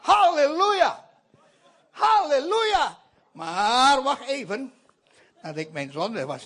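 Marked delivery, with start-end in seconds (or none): 0.0-3.0 praising shout. Acted. Filled with the Holy Spirit.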